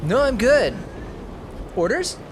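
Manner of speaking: normal talking voice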